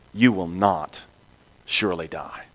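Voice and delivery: soft, low sneaky lie; sly serpent. Acted. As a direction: hiss the lie quietly.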